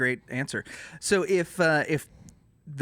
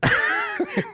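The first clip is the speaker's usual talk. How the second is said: high burst of laughter; sudden shriek of laughter; big laugh; 3 dB louder than usual. Real, not acted. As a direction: crack up at the joke.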